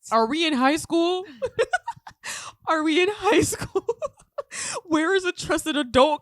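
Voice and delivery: high, loud and slow, laughing through it; laughing disbelief. Retold or imitated. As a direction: cry out in giggling disbelief. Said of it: "And you're just like".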